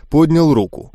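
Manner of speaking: usual speaking voice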